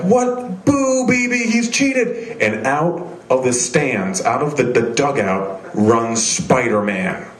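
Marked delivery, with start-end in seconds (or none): 0.0-2.4 high, mock-outraged crowd voice; booing crowd. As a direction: jeer it like the whole stands turning on him. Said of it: "everyone's like".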